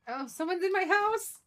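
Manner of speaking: loud, high-pitched startled outburst; jumpy, high and loud; startled alarm. Real, not acted. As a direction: cry out as if finding an intruder. Finds someone in her house.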